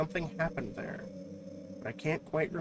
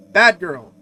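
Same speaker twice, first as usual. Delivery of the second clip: sharp scolding; 2 semitones higher, 16 dB louder than usual. Acted. Mother scolds the girl.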